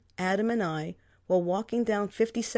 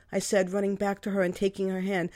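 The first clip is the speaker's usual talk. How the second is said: ordinary delivery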